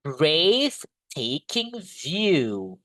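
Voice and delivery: breathtaking very slowly, linked; slow model pronunciation. Shown as a demonstration. Teacher modelling breathtaking for the class. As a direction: stretch breathtaking out as one word.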